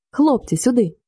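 restrained glad call; joyful cry. A narrator's reading. Andrii calls the lads into the next room, gladly. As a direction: call them over with a touch of joy. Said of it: "радісно скрикнув".

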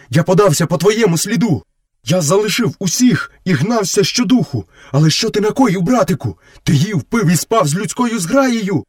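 high-pitched, pleading wolf voice; guilty. A narrator's reading. Wolf explaining why he came late, guilty and high. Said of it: "винувато провадив сірий брат".

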